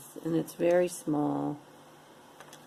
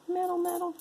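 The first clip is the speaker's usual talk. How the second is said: squeaky high; 13 semitones higher, much faster than usual. Pretend. Playful repeat.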